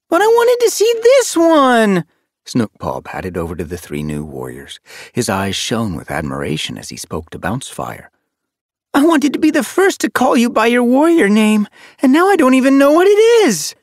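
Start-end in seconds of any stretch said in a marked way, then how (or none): 0.1-2.0 put-on very high, loud young protest; disappointed wail. A narrator's reading. 8.9-13.7 high, loud, breathless-with-eagerness rush; eager youngster. A narrator's reading. Young apprentice gushes to new warrior.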